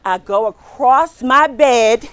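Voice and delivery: ordinary delivery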